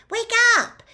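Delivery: high, loud shout; loud wake-up call. Acted. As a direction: rouse the sleepers. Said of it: "she said loudly".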